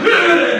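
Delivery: high, loud recoiling ugh; startled groan. Retold or imitated. Frail old woman jolted by the hawker's yell, loud startled ugh. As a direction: recoil with a loud disgusted grunt. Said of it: "The woman's like".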